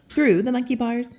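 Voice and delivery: usual speaking voice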